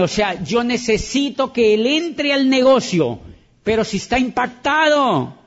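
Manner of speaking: high, driving sermon voice, hammering each line; preaching. Acted. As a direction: preach it to the crowd with full conviction. Preaches to the audience.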